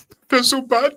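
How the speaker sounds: high, whiny mock sorrow for a stranger. Friend whining over a dead stranger, mock sad high whine. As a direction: pitch it up like a fake mourner.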